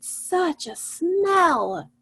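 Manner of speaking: slow cat line. Retold or imitated. Teacher voicing the cat who smelled the hot dog, slow and drawn out. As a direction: savour the smell, stretching every word. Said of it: "and she said".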